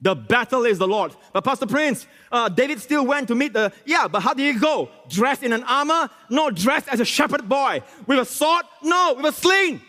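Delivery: high-pitched rapid question and answer; animated preaching. Real, not acted. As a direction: build excitedly to a high climax.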